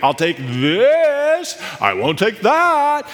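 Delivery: high, drawn-out THIS and THAT; slow, overdone hint with soaring key words; exaggeratedly obvious hinting. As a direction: stretch "this" and "that" way out as a blatant clue. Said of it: "trying to make it really obvious".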